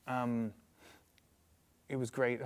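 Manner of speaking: usual speaking voice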